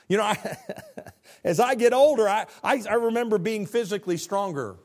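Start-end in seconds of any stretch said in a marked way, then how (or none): none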